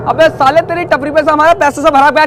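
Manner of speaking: loud, heated accusing rant; angry accusation. Acted. Customer accusing the tea-stall owner of theft, angry. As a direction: accuse him at full volume.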